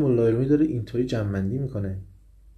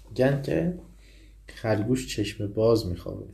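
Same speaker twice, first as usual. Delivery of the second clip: normal talking voice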